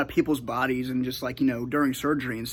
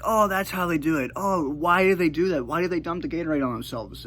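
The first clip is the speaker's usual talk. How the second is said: put-on puzzled little kid; 5 semitones higher than usual. Young self voiced, puzzled.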